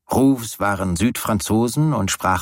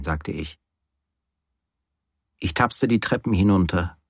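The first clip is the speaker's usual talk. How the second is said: usual speaking voice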